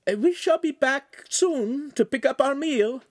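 a refined lady politely declining; signora's voice. Acted.

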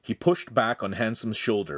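ordinary delivery

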